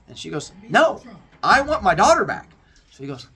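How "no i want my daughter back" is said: high, loud demanding no; indignant refusal. Acted. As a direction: insist loudly on your daughter. Demeter refuses Zeus.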